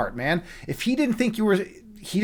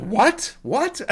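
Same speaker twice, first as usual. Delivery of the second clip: stunned disbelief; 6 semitones higher than usual. Retold or imitated. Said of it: "I was like".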